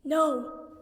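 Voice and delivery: plain reading voice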